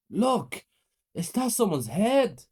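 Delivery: high, slow frightened question. A narrator's reading. Boy to his brother at the table, frightened. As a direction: point it out in rising fear. Child spotting a head.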